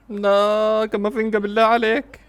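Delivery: high, loud, pleading protest; exasperated cry. Real, not acted. Fan begging Camavinga to stop, high exasperated cry. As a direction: wail at the player.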